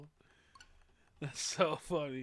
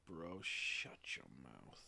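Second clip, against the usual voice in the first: mock menace; 12 semitones lower, 7 dB quieter, slower than usual. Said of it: "it's like".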